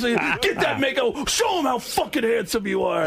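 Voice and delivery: high, shouting mock-violent mom; ultra violent mom. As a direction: mom roughly scrubbing a kid's face, hammed up. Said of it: "like a really ultra violent mom".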